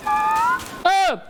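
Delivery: very high startled squeal; real shriek. Real, not acted. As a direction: squeal in sudden terror. Sudden fright at the door.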